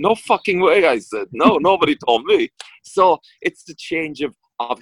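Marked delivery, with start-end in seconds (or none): 0.0-0.9 higher, emphatic mock outrage; playful high-pitched protest; retold disbelief. Retold stunned reply to director. 1.3-2.5 higher-pitched mock indignation; playful, emphatic disbelief; retold protest.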